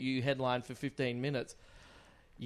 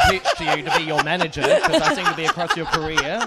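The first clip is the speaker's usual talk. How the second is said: higher, louder and fast with laughs; laughing; 13 semitones higher, 8 dB louder than usual. Real, not acted. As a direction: laugh through the joke pitch. Jokey manager pitch, laughing.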